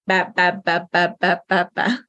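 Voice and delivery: a string of equally weighted ba syllables; even syllable rhythm. Shown as a demonstration. Teacher imitates how Hungarian may sound, even syllable rhythm. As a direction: tap out each ba evenly, like a steady beat. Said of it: "it may sound like".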